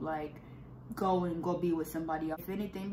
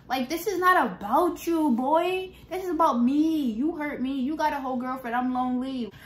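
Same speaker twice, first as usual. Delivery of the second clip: higher, louder hurt comeback; upset retort; 6 semitones higher, 5 dB louder than usual. Retold or imitated. Telling her ex it's about her, higher and upset. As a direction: throw it back at him.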